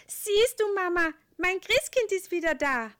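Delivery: put-on high, loud happy boy; excited child voice. A narrator's reading. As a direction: cry it out like a thrilled child. Child character.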